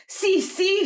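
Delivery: very high, loud eager yes; eager shout. Acted. Fernando eagerly agreeing to learn from Juana, high shout. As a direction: jump at the chance. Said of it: "shouted Fernando".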